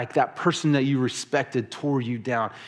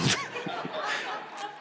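quieter laugh fading out; soft trailing laugh; 7 dB quieter than usual. Real, not acted. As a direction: let the laugh die away. Laughing at own story.

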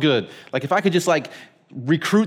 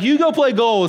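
put-on high, louder kid's order; kid's brush-off; 8 semitones higher, 4 dB louder than usual. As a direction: boss him off to goal. Kid sending him to goal.

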